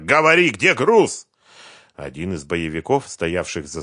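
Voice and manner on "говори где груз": loud, high, shouted demand; angry. A narrator's reading. Фёдор demanding the cargo's whereabouts, angry. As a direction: shout it at him, enraged. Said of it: "разозлился Фёдор".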